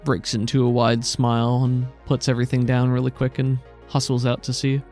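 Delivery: ordinary delivery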